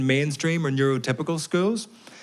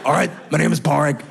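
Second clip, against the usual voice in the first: put-on gruff old smoker voice with a laugh in it; smoker's voice impression; 3 semitones higher, 3 dB louder than usual. Comedian repeats Pádraig's impression as a laughing gruff smoker. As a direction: growl it like a fifty-four-year-old smoker. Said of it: "That's what he talked like".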